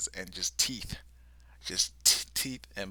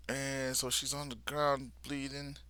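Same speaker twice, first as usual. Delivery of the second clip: normal talking voice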